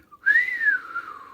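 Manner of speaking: high rising whistle of amazement; a long amazed whistle rising high. Acted. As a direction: whistle up in wonder. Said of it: "whistled Diggory".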